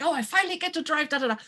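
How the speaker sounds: giddy rush of joy; eager excitement. Retold or imitated. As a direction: gabble like a thrilled teen. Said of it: "super excited".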